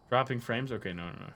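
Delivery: usual speaking voice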